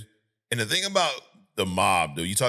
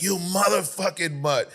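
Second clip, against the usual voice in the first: retold outburst with pitch thrown way up; losing it; 10 semitones higher than usual. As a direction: imitate a hothead blowing up. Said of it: "And he loses it".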